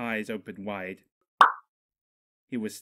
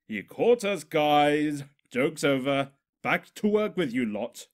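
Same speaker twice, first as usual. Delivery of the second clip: calling out; 4 semitones higher, 6 dB louder than usual. A narrator's reading. Calling out to other ponies.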